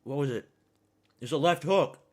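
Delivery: usual speaking voice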